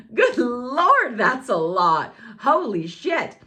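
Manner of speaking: high, loud, hammed-up exasperation; comically overwhelmed. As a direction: build into squealing mock horror at your own intensity. Acting out being overwhelmed by such energy.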